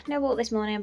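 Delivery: usual speaking voice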